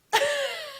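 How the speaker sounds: high burst of laughter. Acted. Friend laughs at talk of Edouard's French accent, high laugh. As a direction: laugh out in amusement.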